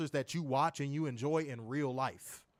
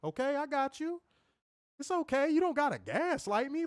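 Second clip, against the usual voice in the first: mock soothing; 10 semitones higher than usual. Mocking chat user.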